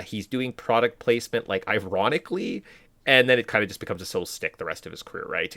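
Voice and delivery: usual speaking voice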